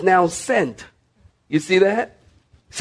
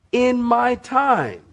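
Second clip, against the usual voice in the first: high, slow "in my time"; God's measured answer; 7 semitones higher, slower than usual. Retold or imitated. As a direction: lift "in my time" high, then let it fall. God's answer, first take.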